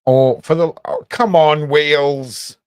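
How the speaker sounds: loud, high frustrated groan at the task; loud exasperated cry at a stubborn dragon; exasperated outburst. Real, not acted. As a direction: blurt it out, fed up with the fiddly drawing. Struggling to draw the Welsh flag.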